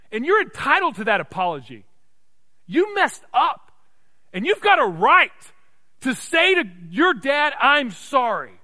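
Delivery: loud, high, emphatic pulpit cry; full-force preaching. Real, not acted. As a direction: ring it out phrase by phrase, with pauses.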